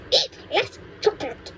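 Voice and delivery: put-on high, cranky old woman lecturing; cranky grandma. Grandma scolds George about growing, in a high cranky voice. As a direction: scold like a mean little granny.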